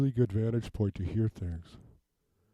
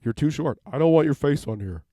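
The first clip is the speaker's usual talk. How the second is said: higher and louder, half laughing reproach; 5 semitones higher, 5 dB louder than usual. Real, not acted. To her child crowding the camera, higher and louder.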